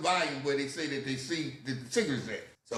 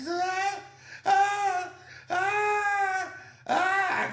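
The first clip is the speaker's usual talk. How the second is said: loud, high repeated wails; exaggerated hollering; 4 dB louder, much slower than usual. Teen hit by police, hollering in the back. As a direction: wail over and over, hamming it up. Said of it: "making all that goddamn noise".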